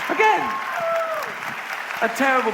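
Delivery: high exasperated exclamation; mock outrage. Comedian protesting another bad moral, mock outrage. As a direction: burst out in indignation. Indignant punchline about moral.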